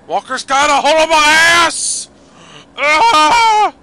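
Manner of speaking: mock panic. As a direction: squeal it like a panicked enemy being grabbed. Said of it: "They're like".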